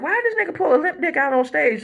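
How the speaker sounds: high-pitched incredulous question; mock bafflement. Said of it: "we were like".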